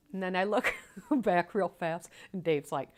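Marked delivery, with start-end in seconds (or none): none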